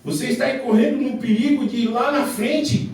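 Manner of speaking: loud, high shouted sermon; fiery preaching. Acted. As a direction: thunder it from the pulpit. Preaching at full force.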